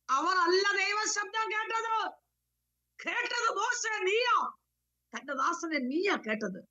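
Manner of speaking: high-pitched, urgent exhortation; impassioned preaching. Real, not acted. (ഉയർന്ന സ്വരത്തിൽ ആവേശത്തോടെ പ്രബോധനം)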